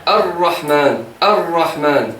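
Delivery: "Ar-Rahman" slow, doubled r held; correct shadda. Shown as a demonstration. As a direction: lean on the r of "Ar-Rahman". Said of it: "Je dis". (« Ar-Rahman » lent, r doublé tenu)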